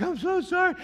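apologetic dog owner. Retold or imitated. Dog owner apologizing to the walker, high-pitched.

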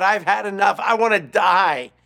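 impassioned acted despair; crying out. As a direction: cry it out to God, at the end of your rope. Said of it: "He cried out to God".

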